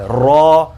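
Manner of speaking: را with rounded lips, full and heavy; a thick rounded را; heavy letter demo. Shown as a demonstration. Teacher models a heavy را. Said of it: "منہ کو گول کر کے اس کی آواز نکالنی ہے".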